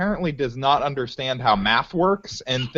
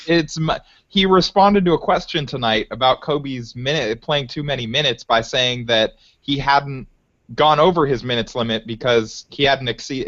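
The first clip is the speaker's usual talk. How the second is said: ordinary talk, nothing marked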